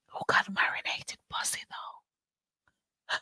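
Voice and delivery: hushed gossipy whisper. Real, not acted. Host whispering over a racy chat comment. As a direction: whisper it like a secret.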